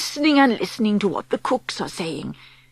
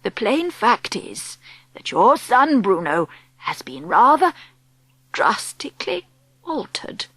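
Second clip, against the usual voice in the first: careful, hesitant. Acted. Grandmother breaking bad news gently.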